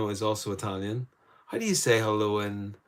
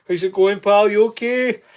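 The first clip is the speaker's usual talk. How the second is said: put-on high, loud jokey Scottish accent; a poor attempt at a Scottish accent, high and bright; 11 semitones higher, 11 dB louder than usual. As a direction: greet a viewer in a hammed-up Aberdeenshire Scottish accent. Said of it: "poor attempt at, um, Scottish accent".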